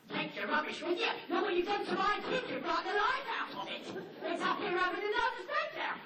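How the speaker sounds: high loud indignant telling-off; indignant dog. Pretend. Dog scolds the postman, high loud and indignant. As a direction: scold him for upsetting the kid.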